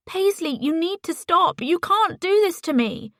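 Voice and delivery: high, strained urgent plea; desperate plea. A narrator's reading. Ellie begging Paisley to stop, desperate plea. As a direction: beg her to stop, voice climbing.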